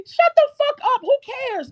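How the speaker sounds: high, loud exasperated yell; real furious outburst; angry shout. Real, not acted. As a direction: shout them down, pitch shooting up. Snaps back at mimicked critics.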